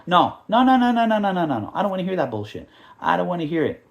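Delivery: high, loud scolding of the fans; scolding rant. Real, not acted. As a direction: tell them off, no excuses allowed.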